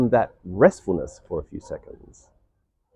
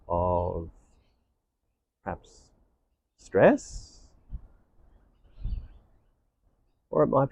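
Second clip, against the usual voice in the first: guided meditation; 4 dB quieter than usual. Real, not acted. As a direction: guide listeners inward, unhurried and hushed.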